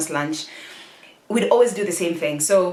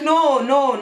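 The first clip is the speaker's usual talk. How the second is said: urgent denial; 7 semitones higher, 5 dB louder than usual. Retold or imitated. Hurriedly denying dad's alarmed guesses.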